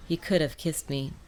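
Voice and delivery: usual speaking voice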